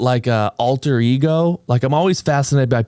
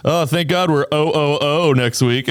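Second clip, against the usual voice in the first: quick, slightly raised office-joke voice; mock workplace humor; 3 semitones higher than usual. Host imitates the guest's tipsy workplace jokes, mock office humor. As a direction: toss out a mock office quip. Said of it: "like, workplace humor".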